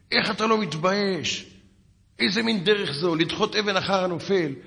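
high-pitched mock scolding over the phone; indignant telling-off, acted out; retold angry yelling. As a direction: imitate an outraged caller, pitched well up. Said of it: "והתחיל לצעוק עליי בטלפון".

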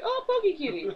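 high-pitched mock squeal of delight; excited cooing. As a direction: coo it high, giggling through the imitation. Acting out Harley spotting a Pokemon.

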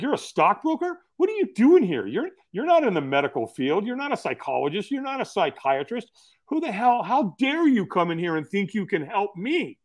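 high, incredulous indignant challenge; mock outrage at an unwelcome visitor; angry retort. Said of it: "Doctor Bob was pissed off".

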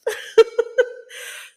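high breathy giggle. Real, not acted. Laughs at own slip.

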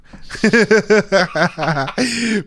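loud, high burst of laughter; real laughing fit. Real, not acted. Podcaster cracking up over the dog's bathroom schedule, loud laughing fit.

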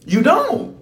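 "You don't" snapped up high; sharp high rebuke. Real, not acted. Preacher rebukes the congregation, sharp and high. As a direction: fling "You don't" high at them like a scolding.

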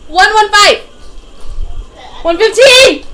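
high, loud chanting shout; mock yelling. Club crowd yelling the weight limit at someone, loud mock shout. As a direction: bellow the weight limit at the door. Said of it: "They're all yelling at you".

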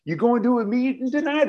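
high, loud bro voice; a high, loud dude voice calling out; loud AA buddy. Retold or imitated. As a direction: burst in on the date like an oblivious pal. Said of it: "somebody's gonna come up to you and go".

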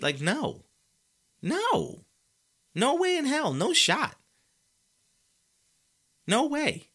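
high incredulous no way; emphatic denial. Real, not acted. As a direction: flatly refuse it, up high. Rant denial.

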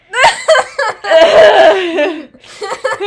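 loud high cackling laugh; long loud cackle. Real, not acted. Hearing the same homework excuse again, a long loud cackle. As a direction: let a long cackle rip.

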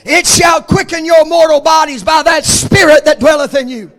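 loud, ringing pulpit cadence, each phrase hammered home; full-force preaching. Acted. Preacher to his congregation, full-force shouted preaching. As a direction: preach it like a revival climax.

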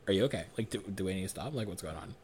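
soft concern. Retold or imitated. Checking on crying partner.

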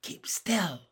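urgent hushed whisper; big sister whispering a warning. A narrator's reading. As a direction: whisper it so nobody hears. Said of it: "Jessie whispered".

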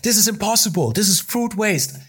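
high-pitched outraged rant without raising volume; squeaky-high mock accusing tirade; retold freak-out. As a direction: mimic a boss going absolutely crazy. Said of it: "he just went absolutely crazy".

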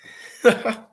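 sudden high laugh; burst of laughter. Real, not acted. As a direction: laugh out loud, caught off guard. Host cracks up at duck voice.

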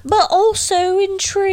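high, exaggerated British echo; mock British accent. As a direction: parrot it in an over-the-top British voice. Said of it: "I can't do a British accent".

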